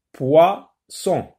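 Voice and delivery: "poisson" in two separate beats, poi - sson; split into syllables. Shown as a demonstration. Teacher counting syllables for pupils, "poisson" said piece by piece. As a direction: break "poisson" cleanly after poi. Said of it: "Deux, euh, syllabes".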